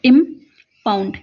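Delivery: Impound said slowly, syllable by syllable; slow dictation. Shown as a demonstration. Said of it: "तो यहाँ बनेगा". (Impound को धीरे-धीरे लिखवाते हुए)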